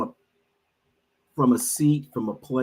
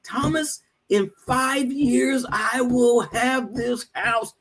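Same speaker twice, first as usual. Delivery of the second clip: put-on much higher, very slow Trinidadian accent, pounding WILL; Trinidadian accent, slow and emphatic after slamming the table; insistent Trinidadian accent; 14 semitones higher, slower than usual. Friend slamming his fist and insisting, in a Trinidadian accent. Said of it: "he says in this thick Trinidadian accent".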